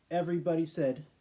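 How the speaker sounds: ordinary delivery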